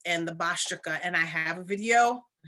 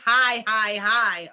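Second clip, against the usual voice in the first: emphatic; 2 semitones higher, 7 dB louder, slower than usual. Real, not acted. Urging viewers to keep vibrations high.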